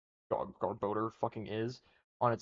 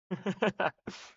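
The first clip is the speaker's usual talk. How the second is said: short laugh; 8 semitones higher than usual. Real, not acted. Laughing at own tier choice.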